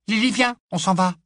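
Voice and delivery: high, loud shrill call; piercing little voice. Acted. Big sister calls her sister away, piercing and high. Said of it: "d'une petite voix perçante".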